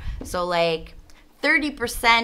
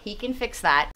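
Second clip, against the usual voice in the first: usual speaking voice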